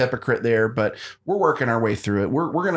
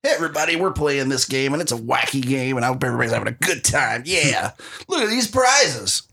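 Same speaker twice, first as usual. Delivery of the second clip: game show host. Pretend. Host welcoming everybody to a wacky game, mock showman voice. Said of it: "that's my best".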